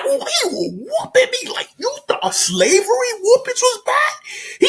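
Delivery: very high, crying exaggeration; hammed-up wailing retelling. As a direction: tell the beating in a mock wail.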